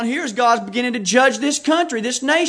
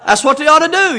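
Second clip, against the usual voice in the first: loud, raised-pitch emphatic declaration; fired-up preaching; 4 semitones higher, 7 dB louder than usual. Real, not acted. Preacher tells congregation how to punish the kid, fired up. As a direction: drive it home loudly to the congregation. Preacher urging a belt for misbehaviour.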